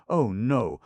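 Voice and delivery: "Oh, no" copied high and loud; emphatic repeat. A narrator's reading. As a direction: push "no" up with full emphasis. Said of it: "much better".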